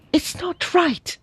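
a lightly voiced outrage at injustice; indignant cry. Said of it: "cried the young man indignantly".

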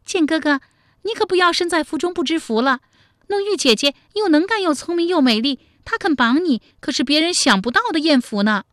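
teasing character voice. A narrator's reading. 巨双 teases 建哥哥 about his night with 弄玉, teasing high voice. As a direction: pitch it way up, playfully teasing. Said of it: "巨双笑道".

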